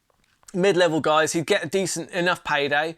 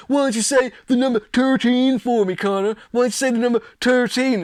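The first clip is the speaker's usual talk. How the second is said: higher, louder taunting voice, sneering; mocking Alvarez; 6 semitones higher, 4 dB louder than usual. Mocking imitation of Alvarez taunting McGregor over his accent. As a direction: jeer at the accent like a schoolyard bully. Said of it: "all he could think of to say to McGregor was to make fun of his Irish accent".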